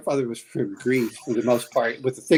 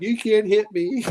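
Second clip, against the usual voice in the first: higher sing-out taunt; mock a daring taunt, pitched up; imitated taunt; 7 semitones higher, 3 dB louder than usual. Grandfather standing up in a red scarf at the enemy, taunting.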